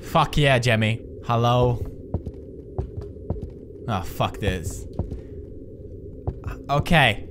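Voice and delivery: normal talking voice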